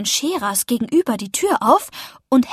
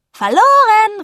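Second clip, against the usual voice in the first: high, loud gloating cry; sing-out taunting shout; gloating call; 10 semitones higher, 7 dB louder, slower than usual. A narrator's reading. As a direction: crow it out over a beaten rival. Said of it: "rief ich".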